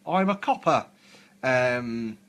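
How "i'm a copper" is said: loud, high caricature British policeman; hammed-up movie British copper. Mimicking the copper from Hellsing, caricature policeman. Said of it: "the British copper was like".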